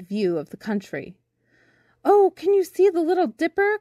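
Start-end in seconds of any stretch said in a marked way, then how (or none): 2.0-3.8 high, louder eager cry; Birdling's high-pitched call from below. A narrator's reading. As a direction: call up to someone on a roof. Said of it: "cried Birdling from below".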